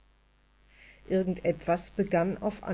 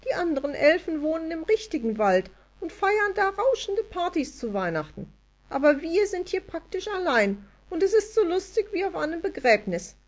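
much higher, glum fairy voice; lonely elf moaning about dull Christmas; sulky elf; 10 semitones higher than usual. A narrator's reading. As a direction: complain like a gloomy tiny elf. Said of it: "wurde noch missmutiger".

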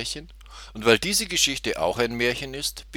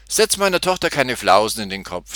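loud, sharp telling-off; scolding mother; 3 semitones higher, 8 dB louder than usual. A narrator's reading. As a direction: scold the goat to protect your child. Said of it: "schimpfte die Mutter".

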